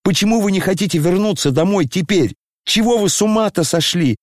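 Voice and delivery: put-on high, fast exasperated boy; pleading in frustration, pitched high. A narrator's reading. Kolya to his father, high and exasperated.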